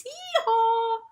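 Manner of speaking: high hee-haw in a jokey bray; donkey bray. Acted. Narrator showing off the donkey's great voice, a high bray.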